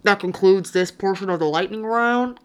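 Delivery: pilot announcement over the intercom; airplane captain voice. Pretend. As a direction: speak like the captain on the PA. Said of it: "your airplane voice".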